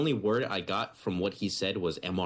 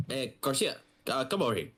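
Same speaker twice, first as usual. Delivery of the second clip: put-on a light Micronesian accent, a bit higher; imitating Micronesian accent; 6 semitones higher than usual. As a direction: mimic the sergeant's Micronesian accent calling you over. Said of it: "he has a strong accent".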